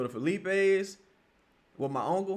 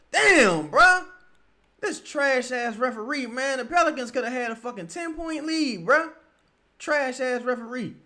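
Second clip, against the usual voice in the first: annoyed complaint; 7 semitones higher than usual. Real, not acted. Complaining about referee.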